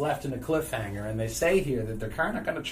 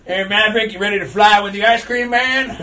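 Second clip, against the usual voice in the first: loud, laughing put-on accent as Iceman; mock accent calling out to Maverick; goofy made-up accent; 7 semitones higher, 11 dB louder than usual. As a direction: ham up a silly improvised accent with a laugh. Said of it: "I don't know why I gave him that accent".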